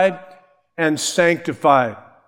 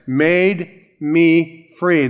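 "made me free" said very slowly, each word weighted; slow down and lean on "me"; emphasized phrase; much slower than usual. Shown as a demonstration. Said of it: "Notice that f-, phrase".

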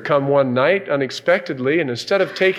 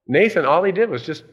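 ordinary talk, nothing marked

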